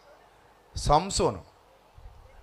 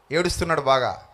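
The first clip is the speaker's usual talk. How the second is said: ordinary delivery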